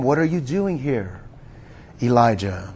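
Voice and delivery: gentle whisper. Acted. God speaks to Elijah in a gentle whisper, hushed and low. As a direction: breathe the question like a still small voice. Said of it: "came a gentle whisper".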